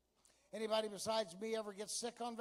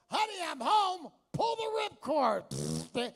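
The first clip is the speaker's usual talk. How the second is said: much higher and louder call, then a slow ripcord line; mock cheerful call; 7 semitones higher, 7 dB louder, slower than usual. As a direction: call out home to the wife, then slowly ask her to pull it. Husband calling out coming home.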